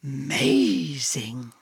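slow, low breathy pant. A narrator's reading. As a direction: pant it in awe. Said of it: "panted Dog".

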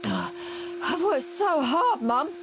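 mock-exhausted, panting plea; gasping. A narrator's reading. Henry faking exhaustion to Mum, gasping plea. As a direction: fake being worn out. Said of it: "gasped Henry".

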